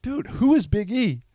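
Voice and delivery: high, incredulous "who is Big E?"; exasperated question. Retold or imitated. Roommates finally ask who Big E is.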